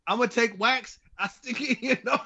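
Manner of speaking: normal talking voice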